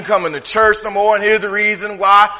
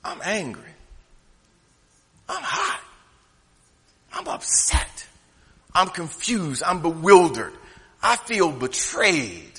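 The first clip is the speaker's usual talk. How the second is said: hushed confession; 4 semitones lower, 11 dB quieter, slower than usual. Retold or imitated. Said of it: "David says".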